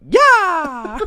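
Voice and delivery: loud, high sustained scream; long, full-force high yell; sudden scream. Shown as a demonstration. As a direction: scream it out like an irresistible urge. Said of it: "just scream out".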